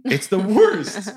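slow, loud, high moan of despair; drawn-out groan. Real, not acted. Dad confessing guilt after the hospital, slow loud groan. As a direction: groan it out, slowly. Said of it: "I feel awful".

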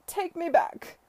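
high-pitched, loud begging; plaintive cry. A narrator's reading. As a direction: beg desperately at the top of your voice. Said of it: "he cried plaintively".

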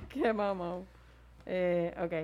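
normal talking voice

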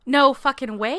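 loud, gasped-out disbelief; astonished disbelief. Real, not acted. Hearing of a listener's worst-ever confession, astonished disbelief. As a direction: react loudly to juicy news. Gasps at worst-thing news.

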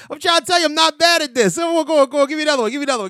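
high, loud, eager demand; excited boasting. Real, not acted. Host begging co-host for another accent, high excited shouting. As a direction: push excitedly for the next challenge.

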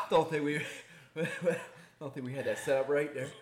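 laughing. Real, not acted. Laughing at their ranking mistake.

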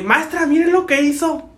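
high-pitched complaint to teacher; imitated annoyed tattling. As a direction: call out like an offended schoolkid telling on someone. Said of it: "se enfadó el vato".

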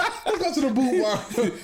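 slow, hammed-up cowboy invite; mock country invite. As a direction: tease it like a country flirt. Mocking a flirty text.

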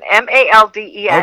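letters spelled one by one, quick. Shown as a demonstration. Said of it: "We don't talk like that".

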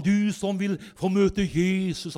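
slow, lightly chanted preacher's call; a hint of a sing-song altar call; imitated intoning revival preacher. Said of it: "han hadde jo sånn litt sånn messende stemme".

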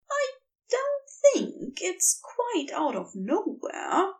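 mildly indignant, hiding nerves; almost cross. A narrator's reading. Angel answers Crowley, a touch cross. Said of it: "almost sounds cross now".

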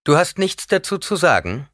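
loud, high demand for an answer. A narrator's reading. Paul pressing the silent old woman, demanding. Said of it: "verlangte Paul".

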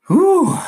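high, loud whoop of relief; sudden high whew, like finishing a rant. Real, not acted. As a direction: let out a big satisfied whew. Satisfied exhale after speech.

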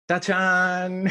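"tachán" high and loud, final syllable held; triumphant fanfare. Shown as a demonstration. Showing what Spanish speakers say, loud fanfare. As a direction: sing out "tachán" like a magic reveal. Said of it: "lo que decimos es".

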